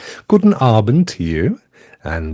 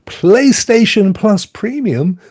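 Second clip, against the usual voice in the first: ordinary talk, nothing marked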